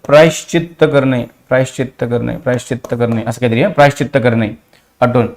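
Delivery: low, soft repeating under the voice; murmured repetition. Real, not acted. Teacher going over a word's meaning, murmured low. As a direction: repeat it quietly, dropped well below teaching voice.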